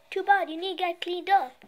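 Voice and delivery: ordinary delivery, nothing marked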